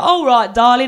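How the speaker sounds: mock London taxi driver; London cabbie accent. London taxi driver greeting his passenger, Cockney accent. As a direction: imitate a chatty London cabbie. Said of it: "one of these London taxi car drivers".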